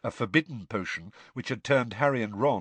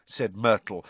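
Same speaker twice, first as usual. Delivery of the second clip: plain reading voice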